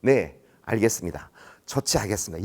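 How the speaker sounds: scripted phone answer. Shown as a demonstration. Boss teaching rookie the stock reply, calm. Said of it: "전화가 오면 다른 말 하지 마".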